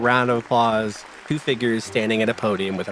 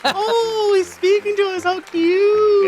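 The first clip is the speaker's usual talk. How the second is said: put-on very high, louder baby-talk coo; cooing townsfolk; 6 dB louder than usual. Crowd coos at the talking dog, very high. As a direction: gush like talking to a puppy.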